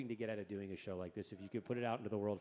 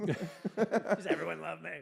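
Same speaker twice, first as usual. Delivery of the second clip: mock grand plea; 8 semitones higher, 9 dB louder, much slower than usual. Host teasing the artist's wish.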